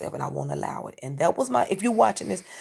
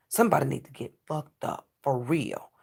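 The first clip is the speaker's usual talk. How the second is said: ordinary delivery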